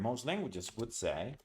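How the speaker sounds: ordinary delivery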